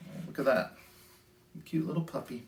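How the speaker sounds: usual speaking voice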